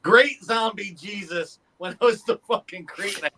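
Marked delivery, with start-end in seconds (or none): 0.0-1.6 slow, unraised recital of the line; walk through the line slowly without yelling; slow mild take on the movie yell; slow retold line. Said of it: "I yelled".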